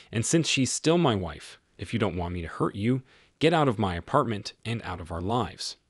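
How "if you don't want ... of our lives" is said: ordinary delivery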